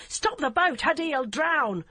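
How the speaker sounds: high, loud frantic shout, slowing at the end; desperate shout. A narrator's reading. Barbara begs the DCI to stop the boat, high loud shout. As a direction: yell for her to stop.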